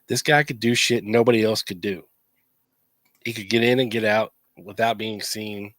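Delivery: ordinary delivery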